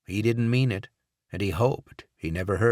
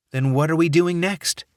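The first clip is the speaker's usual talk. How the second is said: higher, louder, pushing voice; eager question; 10 semitones higher, 6 dB louder than usual. Acted. Watts asks Barrett the plan, high and eager. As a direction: press for the next move.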